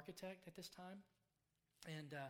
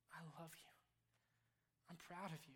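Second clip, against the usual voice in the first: unvoiced tender whisper of God's love; 5 dB quieter than usual. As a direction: breathe it like a secret, no voice. God speaking tenderly to listener.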